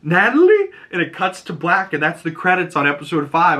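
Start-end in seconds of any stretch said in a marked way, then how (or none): 0.0-0.7 high-pitched questioning call, louder the second time; retold anxious call. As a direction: call out the name in rising disbelief. Retelling a character calling a name.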